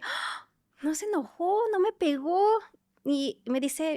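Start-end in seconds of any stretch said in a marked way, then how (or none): 0.0-2.4 relieved surprise. Retold or imitated. Relieved child after a fall.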